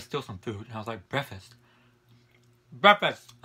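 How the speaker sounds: speaking between bites; talking while chewing. Real, not acted. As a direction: mouth full of food. Seen 1: fork puts food in mouth; food visible in open mouth.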